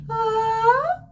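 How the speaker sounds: head voice. Shown as a demonstration. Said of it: "That was your head".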